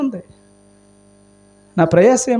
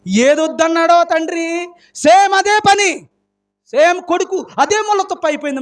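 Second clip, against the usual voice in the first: emphatic outcry; 6 semitones higher than usual. Real, not acted. Preacher's climax on the son.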